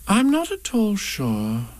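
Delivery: slow, musing high mother voice; thoughtful. Acted. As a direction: ponder it slowly aloud. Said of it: "said Kanga in a thoughtful voice".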